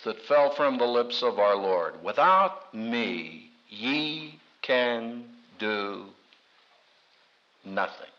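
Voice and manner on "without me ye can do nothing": very slow, soft, weighty; grave, hushed, slowed right down; solemn quote. Acted. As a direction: let each word fall slowly.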